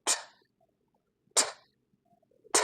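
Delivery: "ঠ" as a tiny voiceless aspirated tick; isolated T sound. Shown as a demonstration. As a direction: release each "ঠ" with a puff, no voice. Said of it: "এর sound হলো". (স্বর ছাড়া ছোট "ঠ" ধ্বনি)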